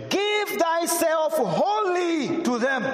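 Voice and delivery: high, very slow, each word stretched; solemn scripture reading. Shown as a demonstration. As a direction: proclaim the verse slowly, lifting it up. Look at the next words.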